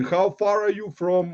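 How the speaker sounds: ordinary delivery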